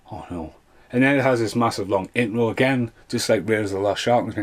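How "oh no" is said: soft, low groan of dismay; quiet dread. Retold or imitated. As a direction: sigh it out under the breath. Dread at long intro.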